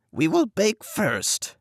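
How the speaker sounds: high, slow sly witch voice; hint at the plan slowly; scheming witch. Acted. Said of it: "said the old woman".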